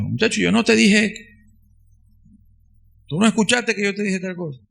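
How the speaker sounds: a hint of a scolding father voice. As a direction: play the exasperated dad telling off his boy. Father scolding son in sermon.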